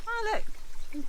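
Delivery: high, gasping mock wonder; mock surprise. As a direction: play the amazed first finder, high.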